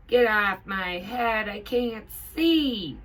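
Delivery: loud, high, slow yell; pilot yelling. A narrator's reading. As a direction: yell in annoyance with a hat on your face. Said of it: "yells the pilot".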